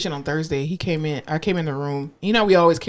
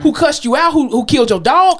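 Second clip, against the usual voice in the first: raised, emphatic list; mock exasperated questioning; 7 semitones higher, 4 dB louder than usual. Therapist asking what upset the patient, mock exasperation. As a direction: rattle off grievances incredulously. Continues acted plea to patient.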